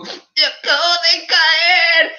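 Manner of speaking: high, drawn-out fake bawling; hammed-up crying voice; mock child's wail. Acts out child crying over fall.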